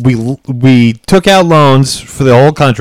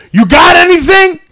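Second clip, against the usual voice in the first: high-pitched imitated yell; shouted demand. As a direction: holler it like a pushy street stranger. Voicing the stranger demanding drugs.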